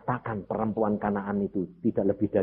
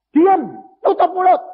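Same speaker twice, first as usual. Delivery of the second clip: harsh rebuke; 12 dB louder than usual. Acted. Disciples silencing the woman.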